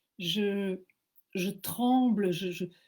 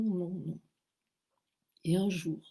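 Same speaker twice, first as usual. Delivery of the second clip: usual speaking voice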